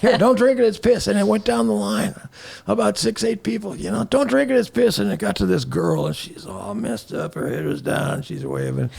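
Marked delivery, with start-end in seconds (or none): 0.0-1.1 high-pitched mock warning; passed-along warning. Guy hands it to the next one, warning him. 4.1-5.0 high-pitched mock warning; passed-along warning. Guy hands it to the next one, warning him.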